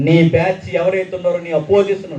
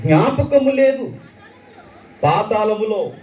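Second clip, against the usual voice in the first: scripture reading; 6 semitones higher than usual. Acted. Reads the psalm with feeling.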